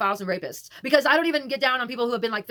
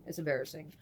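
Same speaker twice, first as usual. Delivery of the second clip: much softer, low mumbled repeat; quiet embarrassed repeat; 6 semitones lower, 11 dB quieter than usual. Real, not acted. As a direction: mutter it again under your breath, sheepish. Repeats it, dropping her voice.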